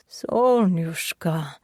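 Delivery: put-on slow, amused Russian accent; hint of laughter. Sasha calling the crying patient by pet name, amused. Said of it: "the faintest hint of laughter in his voice".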